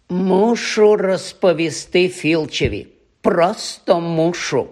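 повільно й високо, вдаючи святенника; тягни слова з удаваною праведністю; удавана святенність. Acted. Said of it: "прикидався святенником Півз". (святенницьким тоном)

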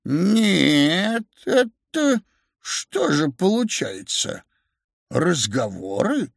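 put-on drawn-out, stunned old man; slow disbelief. A narrator's reading. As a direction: drag the words out, struggling to take it in. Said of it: "сказал старик медленно". (медленно, ошеломлённо)

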